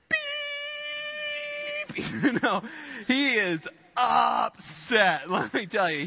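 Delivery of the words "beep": long, very high bleep; imitated censor beep. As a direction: squeal out a censor tone for cursing. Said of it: "this salesman is just like".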